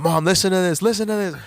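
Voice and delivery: high, eager and loud; breathless excitement about his song on the radio; excited call. Retold or imitated. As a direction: urge her to listen, thrilled. Calling mom as his song plays.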